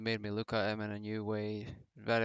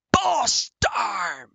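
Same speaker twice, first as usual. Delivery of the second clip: shouted much higher and louder announcer call; booming, stretched emphasis; announcer hype call; 13 semitones higher, 10 dB louder than usual. Retold or imitated. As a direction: sell it like a commentator hyping the entrance. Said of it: "he puts so much emphasis into it".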